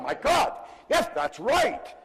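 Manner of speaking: high, loud retold revelation; excited realization. Listener won over by his mentor, excited. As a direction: burst out as if suddenly convinced.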